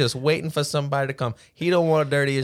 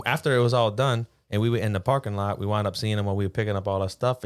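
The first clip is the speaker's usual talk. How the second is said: ordinary delivery, nothing marked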